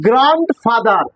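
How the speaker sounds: গ্র্যান্ডফাদার slowly, with গ্র্যান্ড set apart; split into parts. Shown as a demonstration. Teacher shows how to pronounce a hard word, slowly in parts. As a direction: stretch গ্র্যান্ড, then say ফাদার separately. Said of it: "তুমি কিভাবে উচ্চারণ করবো".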